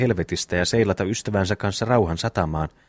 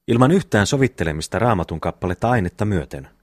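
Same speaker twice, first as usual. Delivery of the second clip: ordinary delivery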